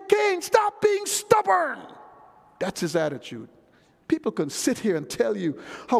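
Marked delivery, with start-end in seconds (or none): 0.1-1.8 high, drawn-out preacher's shout; shouted rebuke. Acted. God telling stubborn Cain off, slow high shout. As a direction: scold him at full force, stretching the words.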